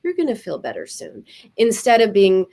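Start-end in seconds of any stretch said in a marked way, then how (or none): 0.0-1.2 lightly voiced tender mother; comforting mother. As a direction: soothe a crying child.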